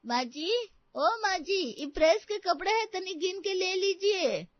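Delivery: very high, loud, drawn-out call; rustic laundry woman. Acted. Laundry woman calls the lady of the house, high rustic voice.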